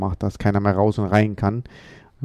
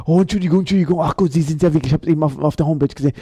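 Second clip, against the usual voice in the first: flustered apology; 6 semitones higher, faster than usual. Retold or imitated. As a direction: gush out apologies, tripping over yourself.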